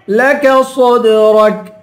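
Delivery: لك صدرك slowly, final ك clearly released; heavy ر, then crisp ك in صدرك; sounded final kaf. Shown as a demonstration. Teacher showing the last letter, صدرك with an audible ك. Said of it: "اس کاف کی آواز نکال کے پڑھیں گے".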